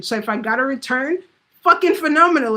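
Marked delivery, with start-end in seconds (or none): 1.6-2.6 fucking phenomenal, pitched up and louder; first take louder and higher; excited. Shown as a demonstration. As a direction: hype it up big. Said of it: "If I didn't get a return".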